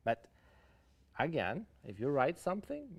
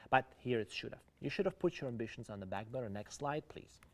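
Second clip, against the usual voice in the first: normal talking voice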